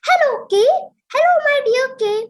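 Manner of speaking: high, loud calling out hello. A narrator's reading. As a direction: call out to the cave, loud and high. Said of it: "He called out in a loud voice".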